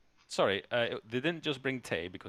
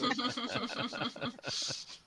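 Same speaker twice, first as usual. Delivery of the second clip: burst of laughter; 14 semitones higher, 3 dB quieter than usual. Real, not acted. Laughing at joke.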